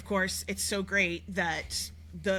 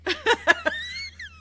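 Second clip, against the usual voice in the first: loud laugh; 5 dB louder than usual. Real, not acted. Laughs at joke.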